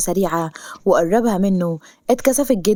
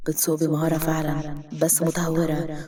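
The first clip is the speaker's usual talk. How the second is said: low, soft musing to himself; hushed, lower aside to self; inner thought; 3 semitones lower, 7 dB quieter than usual. A narrator's reading. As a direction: think aloud under your breath. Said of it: "قصي في نفسه".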